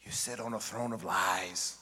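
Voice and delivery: low, slow mock accusation; Buddy the Elf. Elf confronting a fake Santa, low slow accusation. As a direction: draw it out in a deep, solemn tone. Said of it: "And then he finally says".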